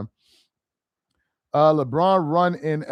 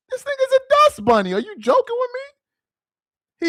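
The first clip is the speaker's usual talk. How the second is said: much higher, incredulous scoff; mocking disbelief. Real, not acted. Streamer mocking the rival who insulted him, high and incredulous.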